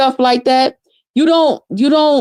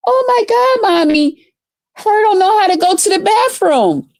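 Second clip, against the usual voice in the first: lightly voiced child, higher pitch, gasping at the scene; little kid voice; 7 semitones higher than usual. Mocking the restaurant kids as a lightly voiced child. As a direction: mimic a shocked little kid. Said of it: "little kids looking and shit like".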